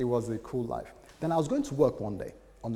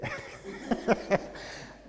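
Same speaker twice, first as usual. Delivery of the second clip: high breathy laugh; 12 semitones higher than usual. Real, not acted. Laughing at script.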